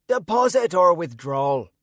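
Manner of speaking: high-pitched yet gravelly Irish voice; Irish leprechaun. A narrator's reading. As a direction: growl it high, with an Irish accent. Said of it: "in an Irish accent and high-pitched yet gravelly voice".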